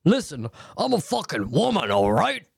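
over-the-top indignant demand, pitch swooping up and down; hammed-up, confrontational swooping delivery; aggressive wrong way. Shown as a demonstration. Advising a listener how not to confront him, mock aggressive. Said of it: "Don't say it like that".

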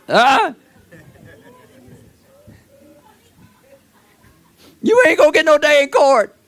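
high, loud preaching with a laugh, slowing at the end; preaching at full force. Acted. Preacher presses the congregation.

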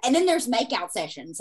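ordinary delivery

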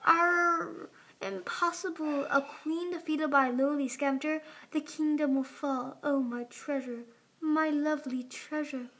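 high, theatrical death speech; mock dying queen. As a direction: lament your treasure as you pretend to die.

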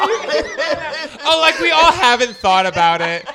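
excited. Real, not acted. Laughing teasing challenge.

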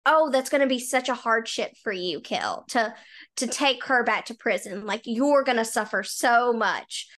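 mock-pitying a complainer; oh-poor-you sarcasm; sarcastic sympathy. Real, not acted. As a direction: lay on the fake concern. Mocking a character's self-centred joke.

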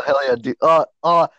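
ordinary talk

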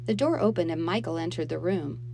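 plain reading voice

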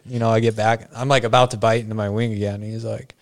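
ordinary talk

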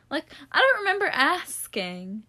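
high, sassy dismissal; sarcastic. Real, not acted. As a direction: brush him off with sass.

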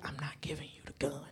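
quick, unvoiced hushed whisper. Mom secretly refusing her daughter the gun, a quick whisper. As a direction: hiss it under your breath so nobody hears.

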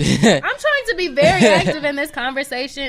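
laughing loudly through the words; laughing protest. Real, not acted. Laughing complaint to cohost.

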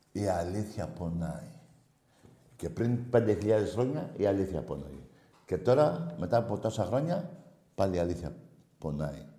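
quiet solemn refrain. Real, not acted. Host to callers, a quiet solemn refrain. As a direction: let the truth sink in quietly.

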